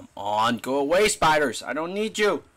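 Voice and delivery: loud, annoyed yell; yelling at spiders. Real, not acted. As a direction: shout the spiders off.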